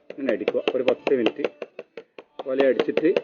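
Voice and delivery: ordinary talk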